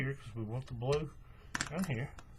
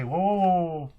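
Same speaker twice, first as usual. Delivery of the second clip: loud, startled whoas, rising then falling; real surprise, loud repeated whoa; sudden whoa; 4 semitones higher, 10 dB louder than usual. Real, not acted. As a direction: catch a mistake just in time. Spots a mistake mid-splice.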